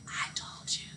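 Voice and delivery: silent mouthing, just breath; mouthed. A narrator's reading. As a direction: barely breathe it, a secret to him alone. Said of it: "she mouthed".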